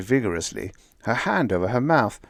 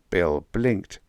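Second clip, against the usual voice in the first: ordinary delivery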